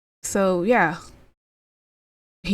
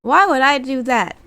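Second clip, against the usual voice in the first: high mocking mock innocence, louder; sarcastic; 5 semitones higher, 6 dB louder than usual. Rollins sarcastically mocking Punk, high mock-innocent sarcasm. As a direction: play innocent, dripping with sarcasm. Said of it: "Seth Rollins being sarcastic".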